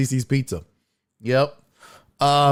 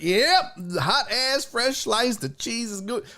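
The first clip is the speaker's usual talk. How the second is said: high-pitched relishing exclamation; gleeful approval; 8 semitones higher, slower than usual. Retold or imitated. Diner seeing fresh pizza pulled out, gleeful. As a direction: gloat over it in a raised pitch.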